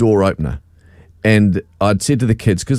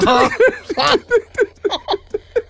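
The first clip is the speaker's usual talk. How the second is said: long high-pitched laugh. Real, not acted. Dad laughing at his own blunder. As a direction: burst into squealing laughter. Reveal of remote in pocket.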